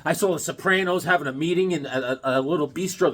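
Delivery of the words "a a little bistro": usual speaking voice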